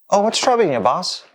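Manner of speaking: all ears, eager to please; eager young worker. Acted. Eager worker answers his boss.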